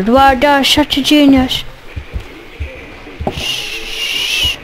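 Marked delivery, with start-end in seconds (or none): 3.3-4.6 drawn-out hushing hiss; long shush. Real, not acted. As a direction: shush the noisy radio at length. Shushes the game's radio.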